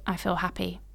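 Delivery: ordinary talk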